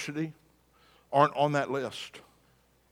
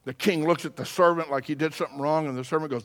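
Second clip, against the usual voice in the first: ordinary delivery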